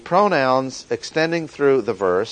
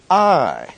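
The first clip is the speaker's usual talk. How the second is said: "I" pitched up high and held alone; emphatic I; 5 semitones higher, 4 dB louder than usual. Shown as a demonstration. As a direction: set "I" apart, high then falling. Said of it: "emphatic pronoun".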